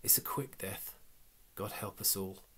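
whispered inner thought; a hushed thought to himself. Acted. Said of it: "thought Belacqua".

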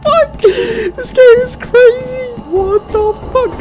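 high slow frightened outcry; shaky scream. Real, not acted. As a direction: wail in real fright.

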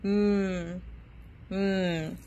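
soft, hummed "mm" with closed lips; the letter M as a long soft hum; m sound. Shown as a demonstration. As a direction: hum the M sound softly for the class. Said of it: "Y dice".

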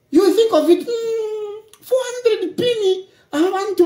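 high, slow, half-crying disbelief; incredulous. Real, not acted. Marvelling at the rival's huge sum, incredulous. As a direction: stretch it out as if it can't be true.

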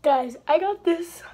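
excited. Real, not acted. Excited slime-making brag.

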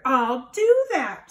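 high, loud, slow screech. Acted. Grouchy ladybug screeching at the friendly one. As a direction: screech it in a huff. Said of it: "screeched the grouchy ladybug".

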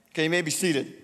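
ordinary delivery

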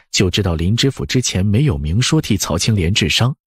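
plain reading voice

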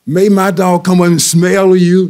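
calling across the street. Retold or imitated. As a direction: call over the street to strangers, mock neighbour voice. Re-enacting the neighbour calling.

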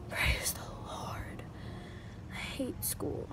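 soft, slow whisper; whispering to viewers about skipping school; whispered aside. Real, not acted. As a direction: whisper it like a secret prayer. Hopes for a snow day, whispered.

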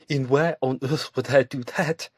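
scoffing. A narrator's reading. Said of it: "she scoffed".

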